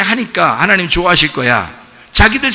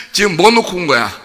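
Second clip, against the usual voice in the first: usual speaking voice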